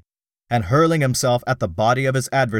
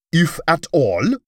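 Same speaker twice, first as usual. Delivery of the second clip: incredulous roar, higher and louder; 6 semitones higher, 3 dB louder than usual. A narrator's reading. Dak Kova to his Jeddak, a roaring scornful echo. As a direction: throw his words back at him in a roar. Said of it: "roared Dak Kova".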